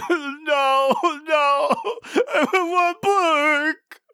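high, wailing mock sob of a child denied a toy; mock crying. [name] fake-wailing at Blizzard for the book, high mock sob. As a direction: whine it like a kid throwing a tantrum, voice cracking into a cry.

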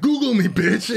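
put-on bratty kid. Quoting nephew again.

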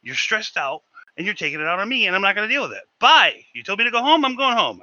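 rising from firm to a loud, high bye; building angry retort. Retold or imitated. Cook telling off the stressed chef, building to a loud shout. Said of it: "I said".

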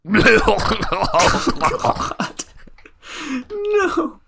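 very high, tearful mock cry; hammed-up crying protest; mock wailing. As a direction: wail in exaggerated grief. Player wails at Amos's sacrifice.

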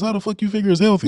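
high-pitched disbelief; incredulous protest. Real, not acted. Scoffs at the healthy claim.

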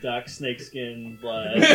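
ordinary talk

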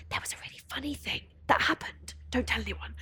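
hushed, conspiratorial whisper; secret whisper. Retold or imitated. A grieving friend confiding the funny moment, whispering.

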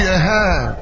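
ordinary talk, nothing marked

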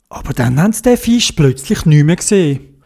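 ordinary delivery

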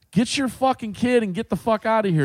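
heated retold outburst; angry order, retold. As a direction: imitate an irate man ordering her out. Retold quarrel with a mother.

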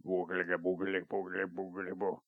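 low, soft creature burble; troglodyte voice. Pretend. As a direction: gurgle it like a cave creature. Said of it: "That sounds like a troglodyte".

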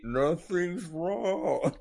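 very slow drawn-out answer; deadpan slow-mo. Retold or imitated. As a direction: stretch it out like slowed audio. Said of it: "his answer will be".

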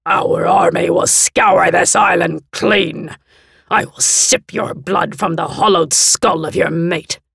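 snarling angrily. A narrator's reading. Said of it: "snarling angrily".

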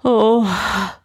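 heavy breath into a gasped oh; breathing heavily. A narrator's reading. Ibrahim after slamming the door, breathing heavily. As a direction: sigh it out, catching the breath. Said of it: "started to breathe heavily".

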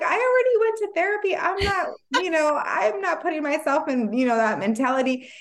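high, breezy, know-it-all voice; smug self-assured. Retold or imitated. Mocking her past self's confidence about therapy. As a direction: brush it off like you're already fixed. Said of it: "I'm like".

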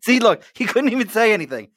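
high laughing teasing line. Real, not acted. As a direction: laugh through the words. Laughing at guest's silence.

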